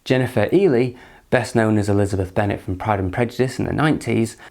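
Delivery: normal talking voice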